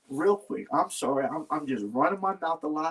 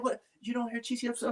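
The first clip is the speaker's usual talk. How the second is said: high but softer mom voice, held back; toned-down mom imitation; 7 semitones higher, 7 dB quieter, faster than usual. Son repeats mom's scolding after deciding to tone it down, softer high mock scold. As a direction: repeat her line softly, still pitched up. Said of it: "She said".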